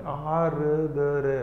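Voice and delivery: आर्द्र stretched slowly as aa-re-da-re; slow stretched word; drawn-out demo. Shown as a demonstration. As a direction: draw आर्द्र out so every sound is heard. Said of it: "थोड़ा सा sound लानी".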